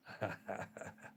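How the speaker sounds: quiet, breath-only laugh; soft chuckle. Real, not acted. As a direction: chuckle softly at your own joke. Laughs at being lost uncle.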